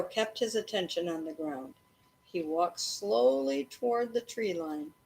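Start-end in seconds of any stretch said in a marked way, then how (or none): none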